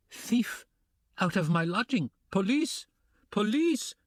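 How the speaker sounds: high shrieking yell for the police; landlady screeching in alarm. Acted. Amalia yelling at Sonia, high shriek. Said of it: "yelled Amalia Ivanovna".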